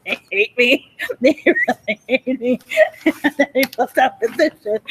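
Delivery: talking through bursts of high laughter; laughing hard. Real, not acted. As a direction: crack up between the words. Breaks up laughing while talking.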